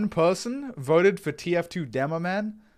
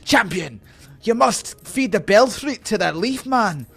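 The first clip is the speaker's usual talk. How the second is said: high, loud dwarf champion-summoner; Moradin; 8 semitones higher, 6 dB louder than usual. Acted. Dwarf urging his champion on a quest, high loud character voice. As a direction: call out urgent orders, pitched well up. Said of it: "Moradin, the Moradin one".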